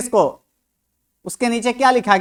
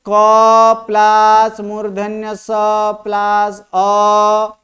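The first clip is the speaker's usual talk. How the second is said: each part drawn out slowly; slow spelling-out; 3 dB louder, much slower than usual. Shown as a demonstration. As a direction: stretch every sound as you spell it for a class. Spells out conjunct for students. (धीरे-धीरे खींचकर वर्ण जोड़ना)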